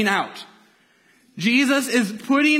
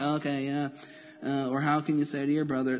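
softer, dull mock Bible reading with no life in it; mock-bored, quieter reading; bored flat reading; 7 semitones lower, 8 dB quieter than usual. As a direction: skim the verse as if it's nothing. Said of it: "sometimes we read the Bible and like".

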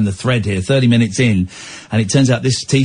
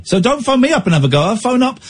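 high, rushed telling-off; mock indignation; 10 semitones higher than usual. Host threatening to phone caller's relatives, mock indignation. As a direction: scold in a high, hurried mock huff.

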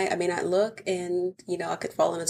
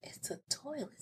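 mock whisper; 18 dB quieter than usual. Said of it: "you'd be like".

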